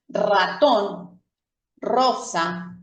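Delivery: "ratón" con la r vibrante como un motor; r fuerte. Shown as a demonstration. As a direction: vibrar la r de "rosa". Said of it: "es una R fuerte". (r múltiple en "ratón")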